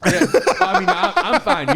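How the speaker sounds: mock defensive squeak. Defensive after being insulted.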